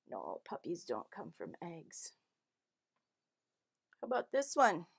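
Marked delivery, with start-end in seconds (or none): 0.1-2.1 soft secret whisper. Acted. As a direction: whisper the answer to the kids. Answer told to children.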